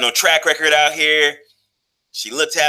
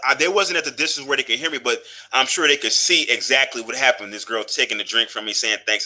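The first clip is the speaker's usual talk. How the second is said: ordinary delivery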